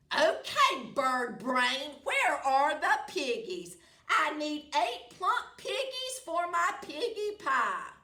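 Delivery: loud, high bossy witch; witch demanding. Acted. Witch demanding piggies from the chicken. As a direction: boss the chicken around.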